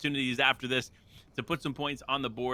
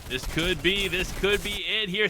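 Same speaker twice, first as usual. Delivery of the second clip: esports caster; 6 semitones higher, 5 dB louder than usual. Acted. Hyped play-by-play of final fight.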